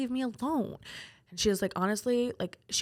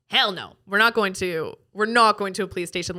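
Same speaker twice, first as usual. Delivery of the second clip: acted flat-out refusal, louder; emphatic refusal; 7 dB louder than usual. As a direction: dig in and refuse, loudly. Retold refusal to go to police.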